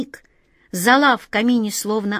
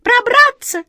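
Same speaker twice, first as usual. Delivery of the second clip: loud, very high piping squeak; high cartoon mouse voice; squeaky mouse; 7 dB louder, slower than usual. A narrator's reading. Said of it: "пискнула вторая".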